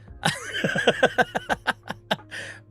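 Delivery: high-pitched helpless giggling; laughing fit. Real, not acted. Streamer tickled by picturing Alan Shearer in an office, high giggling laugh. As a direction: burst into a long, high laugh.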